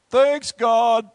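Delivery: sarcastic thanks. Acted. Sarcastic thanks to God for dungeon.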